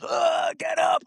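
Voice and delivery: very high, roaring mock coach shout; imitated angry yell. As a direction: imitate a coach bellowing at a downed fighter. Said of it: "I just see Ken's face like this".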